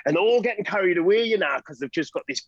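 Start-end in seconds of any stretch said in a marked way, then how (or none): none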